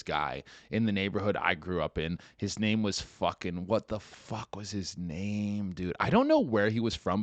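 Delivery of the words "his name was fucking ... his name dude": ordinary delivery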